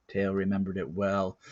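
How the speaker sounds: ordinary delivery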